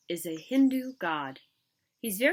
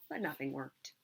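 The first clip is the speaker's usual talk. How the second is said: soft whispered narration; hushed; 5 semitones lower, 8 dB quieter than usual. A narrator's reading. Narrator quietly tells the failure, whispered. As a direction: drop to a whisper. Sad turn in story.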